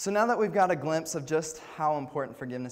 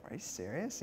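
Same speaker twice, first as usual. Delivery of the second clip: softer, hushed private disbelief; quietly taken aback, as if reading it to yourself; mild disbelief; 2 semitones higher, 7 dB quieter than usual. Retold or imitated. Preacher recalling reading the verse, softer mild disbelief. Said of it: "I was like".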